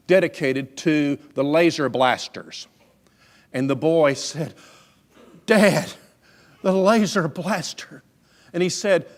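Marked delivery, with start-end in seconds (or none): 5.5-8.0 put-on high, loud burst of a child's excitement; wide-eyed little boy's awe, pitched up; excited boy. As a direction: exclaim it like a thrilled kid, stretching the words. Excited boy in a toy store.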